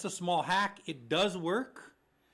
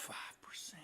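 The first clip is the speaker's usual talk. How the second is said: stunned whisper; 17 dB quieter, slower than usual. Real, not acted. Host reacting to new five percent rule, stunned whisper. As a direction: breathe it out voiceless, in disbelief.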